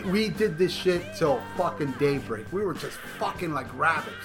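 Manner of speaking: normal talking voice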